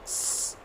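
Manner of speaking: क्स breathed out unvoiced and soft; whispered ks. Shown as a demonstration. Teacher showing kids the X sound as a soft ks. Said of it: "एक्स की sound आएगी क्स".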